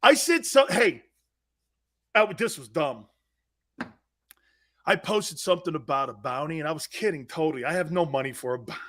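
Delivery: usual speaking voice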